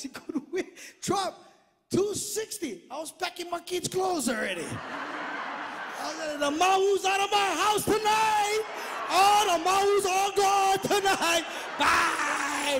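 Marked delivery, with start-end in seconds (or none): 6.4-12.8 loud, higher, triumphant mock send-off; gloating shout. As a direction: crow it like a dad kicking the kids out. Dad gloating, kicking kids out.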